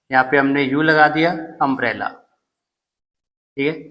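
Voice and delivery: ordinary delivery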